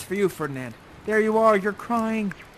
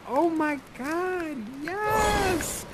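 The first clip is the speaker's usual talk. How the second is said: high, drawn-out swoon; swooning delight; 9 semitones higher, much slower than usual. Pretend. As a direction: squeal it slowly with mock delight. Cheers on the lovers' kiss.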